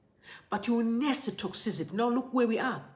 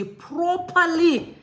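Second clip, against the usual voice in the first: "properly" punched higher and louder, drawn out; stress on PROPERLY; 7 semitones higher, 5 dB louder, much slower than usual. Retold or imitated. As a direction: lean hard on the word "properly". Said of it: "He emphasized on the word properly".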